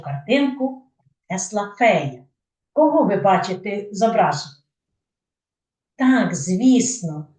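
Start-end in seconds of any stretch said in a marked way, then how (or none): none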